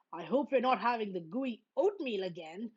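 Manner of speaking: ordinary delivery, nothing marked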